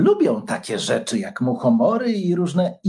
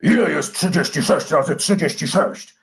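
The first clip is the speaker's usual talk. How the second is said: a mild, gloomy dragon roar on Ile. A narrator's reading. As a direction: growl the question sullenly. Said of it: "zaryczał ponuro".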